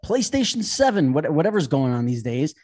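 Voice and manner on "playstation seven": plain reading voice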